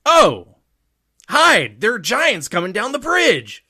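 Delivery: high, loud panicked warning; panicked yell. Acted. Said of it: "And then Usopp goes".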